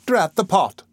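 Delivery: hammed-up mild vexation; mild cross exclamation. A narrator's reading. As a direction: snap at the scalding pot. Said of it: "said the worthy matron".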